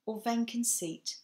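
usual speaking voice

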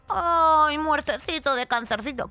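high, loud, cooing fake pity; mock pity. Mocking how people pity a death from cancer, cooing. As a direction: coo it in mock sympathy.

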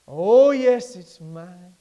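soaring preacher cadence, slow and sung out; whooping preacher build; preaching at full force. Acted. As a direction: lift it to the rafters. Preaching climax, sung line.

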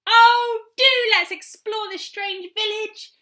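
high, slow, thrilled plea; delighted cry. A narrator's reading. Molly begging Peter to explore the village, delighted. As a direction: beg to explore, full of delight. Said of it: "cried Molly in delight".

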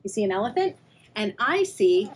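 ordinary delivery

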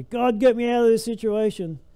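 high, loud panicked cry to God; panicked plea. Pretend. Believer panicking in the storm, crying out frantic and high. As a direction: beg God in a frantic rush. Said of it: "if we panic and we say".

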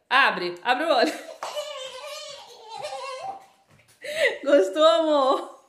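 loud, gleeful surprise reveal; excited reveal. Real, not acted. Mother showing her daughter the surprise cake, excited and laughing. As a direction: call it out loudly through real laughter.

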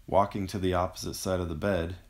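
ordinary delivery, nothing marked

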